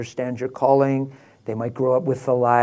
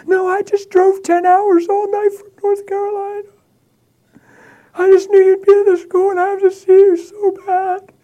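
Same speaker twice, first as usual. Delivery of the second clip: very high, louder, sobbing woman's voice; desperate crying lady; 7 dB louder than usual. Retold or imitated. Distraught woman pleading with the pastor after driving all night, high sobbing. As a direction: wail it like a stranger who drove all night.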